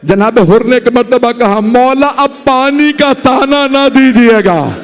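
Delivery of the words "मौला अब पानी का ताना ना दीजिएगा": grieving plea. Acted. Hurr ashamed, pleading with Husain.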